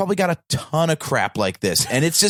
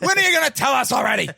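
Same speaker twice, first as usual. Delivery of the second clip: impatient shout; 12 semitones higher, faster than usual. Pretend. Impatient fan demanding Maz's story.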